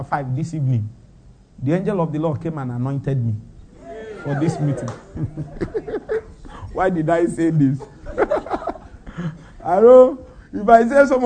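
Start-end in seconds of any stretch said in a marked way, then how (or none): 5.1-6.2 giggle. Real, not acted. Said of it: "the way I'm laughing now".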